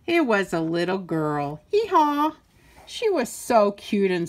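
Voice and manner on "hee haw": high braying hee-haw; donkey bray. Acted. Reader brays for the donkey child.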